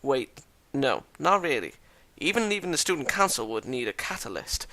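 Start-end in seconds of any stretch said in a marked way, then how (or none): none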